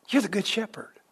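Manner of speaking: voiced only as a whisper, soft and breathy. Preacher whispers the disciples' answer, a hushed whisper. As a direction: hush it, like a secret confession of faith.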